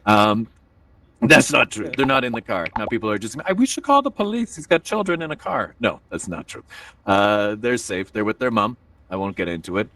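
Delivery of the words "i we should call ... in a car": high-pitched worried onlooker; mock alarm. Audience member hears kids are in car, mock alarm. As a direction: imitate a scandalized bystander, starting high.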